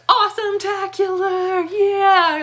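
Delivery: high, loud, drawn-out cheer; Jeremy Johns catchphrase. Acted. Reviewer announcing the year's first top rating, hyped catchphrase cheer. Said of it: "has a little thing when he says Awesome-tacular".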